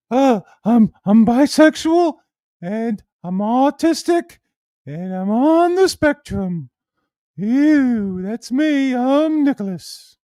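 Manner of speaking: very high, loud, drawn-out mock voice; hammed-up squeaky impression, stretching the words; imitation of Nicholas. Streamer introducing himself as the mocked man, high put-on voice. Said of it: "He sounds like that".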